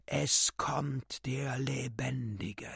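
slow, eerie whispered creature voice; creature cry. Acted. The great beast in the air cries out in an eerie whisper. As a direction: breathe it slowly like an uncanny beast. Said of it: "torkelte und schrie".

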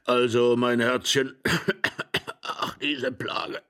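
pitiful coughing croak; croaking old man. A narrator's reading. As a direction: wheeze it pitifully. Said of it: "mit kläglicher Stimme". (kläglich krächzender Greis)

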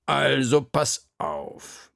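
slow, drawn-out teacher's warning; softened sternness. A narrator's reading. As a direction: spell out terms, no longer quite angry. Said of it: "war schon nicht mehr ganz so böse". (langsam und gedehnt, nur noch leicht streng)

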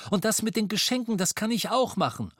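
high, quick, keen offer; eager boy. Acted. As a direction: rush it out high, volunteering eagerly. (hoch und schnell, eifriger Junge)

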